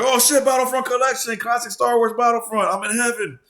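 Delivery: very high, loud, thrilled gush; overjoyed. Retold or imitated. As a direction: react like a kid on Christmas morning. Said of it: "I'm like".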